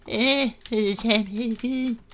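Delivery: a hammy, failed go at Cartman; bad Cartman impression. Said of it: "it was a bad impression".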